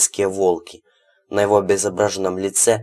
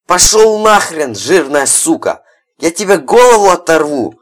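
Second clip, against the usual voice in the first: screaming hoarse through a door; furious shout; 9 semitones higher, 11 dB louder than usual. A narrator's reading. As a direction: yell with all your fury. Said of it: "прокричал Беркут".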